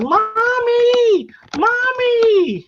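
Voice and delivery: high frightened wail for mommy, imitated; child screaming for mommy. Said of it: "she's screaming".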